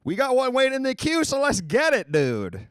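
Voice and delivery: high, loud rallying shout; hyped-up announcing. Real, not acted. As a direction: fire up the crowd for the next caller. Host hyping the phone lines open.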